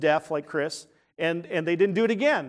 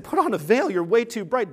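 excited telling; 5 semitones higher, 5 dB louder than usual. Retold or imitated. People telling Moses to veil.

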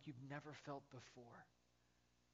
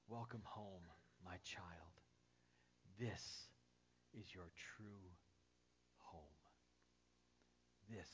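lower, much softer, gentle and slow; tender Jesus; 6 semitones lower, 11 dB quieter than usual. Acted. Jesus welcoming each believer home, soft and tender. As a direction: murmur it like holding a child close. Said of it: "He is gonna say".

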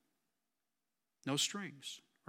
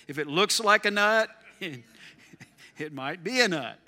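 laughing through the joke, louder at first; 5 dB louder than usual. Real, not acted. Preacher joking to congregation about nutty neighbors, laughing. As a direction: chuckle out the punchline.

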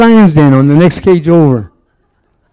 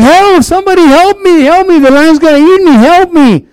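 high panicked cries for help, much higher; yelling for help; 10 semitones higher, 3 dB louder than usual. Retold or imitated. Man in gorilla suit yelling to zoo crowd, high panicked screams. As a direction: scream for help like the lion's about to eat you. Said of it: "He starts yelling".

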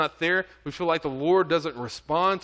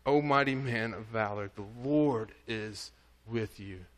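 tender words of God; 5 semitones lower, 4 dB quieter than usual. Acted. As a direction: speak it gently, like a blessing. God speaks truth to Gideon.

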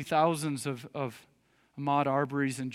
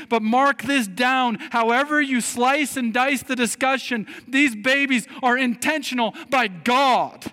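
raised, high-pitched preacher's plea; impassioned preaching; 9 semitones higher, 7 dB louder than usual. Acted. Preacher urging protest for babies' lives, impassioned preaching. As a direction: press the argument hard, voice lifted.

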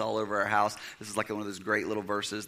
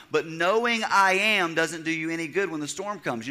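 usual speaking voice

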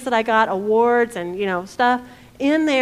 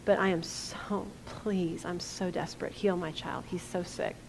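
softening, pleading father; a father begging quietly for his sick child; desperate plea; 5 semitones lower, 8 dB quieter than usual. Retold or imitated. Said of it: "he goes to the rabbi and says".

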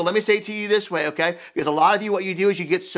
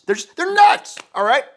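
much higher, louder: they're nuts; exasperated outburst; 7 semitones higher, 6 dB louder than usual. Real, not acted. Pastor venting to the church about parents' wild kids.

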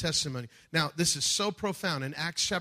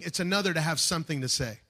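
normal talking voice

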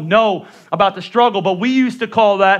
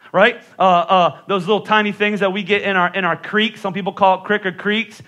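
ordinary delivery

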